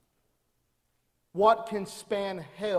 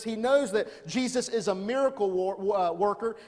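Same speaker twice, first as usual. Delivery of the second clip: ordinary delivery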